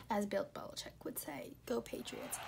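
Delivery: unvoiced whisper quote; whispered aside. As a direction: hush the quote.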